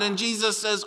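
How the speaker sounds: ordinary talk, nothing marked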